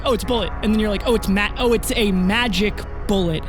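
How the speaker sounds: ordinary talk, nothing marked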